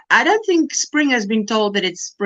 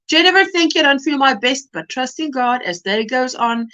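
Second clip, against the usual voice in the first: normal talking voice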